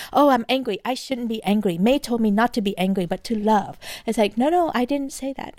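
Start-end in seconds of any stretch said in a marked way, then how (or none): 0.1-3.8 hurried, worked-up inner chatter; mock self-scolding. Coach mimics the brain that goes to should, mock fretful. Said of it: "Don't shoot yourself. Don't go". 4.4-5.5 mock protest. Acts out her own protest.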